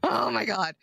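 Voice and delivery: laughing despair. Real, not acted. Exasperated mom after child leaves.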